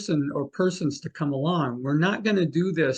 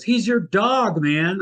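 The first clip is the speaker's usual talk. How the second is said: slow, higher, louder reveal; mock psychic; 5 semitones higher, 5 dB louder, slower than usual. Psychic revealing the dog's name to the sitters, slow and mocking. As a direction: spell it out slowly like a know-it-all psychic. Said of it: "Thomas John finally says".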